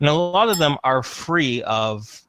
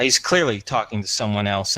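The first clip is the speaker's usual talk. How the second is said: ordinary talk, nothing marked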